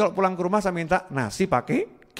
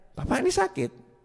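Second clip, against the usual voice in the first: curious visitor; 12 semitones higher, 5 dB quieter than usual. Retold or imitated. Voicing a visitor's question.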